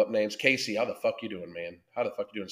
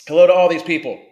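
loud greeting; 4 semitones higher, 10 dB louder, faster than usual. Real, not acted. Calling out greeting to chat.

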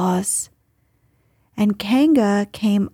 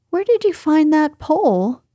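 higher, keen questioning; surprised question; 6 semitones higher than usual. A narrator's reading. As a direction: ask it with sudden interest, pitched up. Christopher Robin questions Pooh in surprise.

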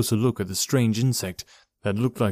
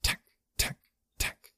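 soft voiceless insect clicks; sharp clicking sound; 9 dB quieter than usual. A narrator's reading. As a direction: click from the throat like a bug. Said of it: "only a sharp clicking sound reverberated from him".

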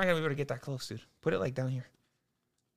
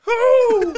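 shrieking ooh; 19 dB louder than usual. Real, not acted. Reaction to smelling salts.

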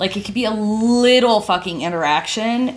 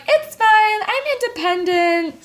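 high, airy imitation of partner; mock breezy; 4 dB louder than usual. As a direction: brush it off in a put-on voice.

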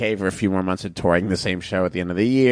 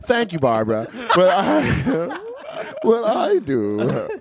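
talking through giggles, pitched up; real laughter breaking up the words; laughing; 7 semitones higher, slower than usual. Real, not acted. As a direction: crack up while trying to answer. Cracking up over repeated banter.

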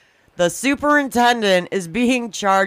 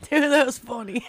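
laughing; 6 semitones higher, faster than usual. Real, not acted. Laughing at friend's choking.

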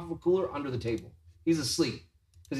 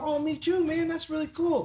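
much higher, friendly voice; imitating the nice artist; 11 semitones higher than usual. Teller imitating the young artist answering him, high and friendly. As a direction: lift it high and beam. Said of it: "He's like".

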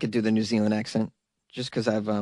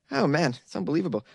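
ordinary talk

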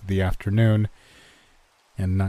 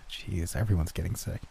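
muttered under breath; 2 semitones lower, 8 dB quieter than usual. Acted. Muttered thought at work.

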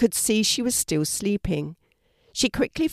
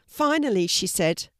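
ordinary delivery

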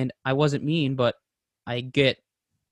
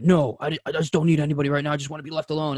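higher, quick exasperated brush-off; exasperated but not yelling; 4 semitones higher, 3 dB louder, faster than usual. Retold or imitated. To his girlfriend offering help, a quick, higher exasperated brush-off. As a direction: push her away fast without yelling. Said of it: "I'm not yelling at her".

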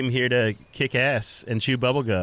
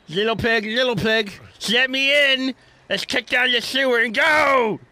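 big bad wolf call; 11 semitones higher, 4 dB louder than usual. Pretend wolf at the door.

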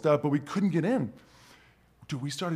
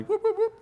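very high quick whoops; whooping sound effect. Retold or imitated. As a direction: chirp a playful whoop whoop. Imitated sound of swindling.

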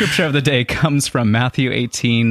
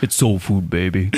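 half-successful soul-man voice; attempted deep voice. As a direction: try for a deep soul croon. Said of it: "sometimes I can get that deep voice".

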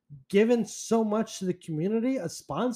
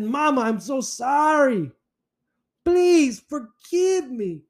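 hammed-up pleading, higher and slow; mock begging; 8 semitones higher, 4 dB louder than usual. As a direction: beg forgiveness on your knees, overdone. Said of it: "I got on my knees and I said".

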